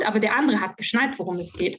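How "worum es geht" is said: normal talking voice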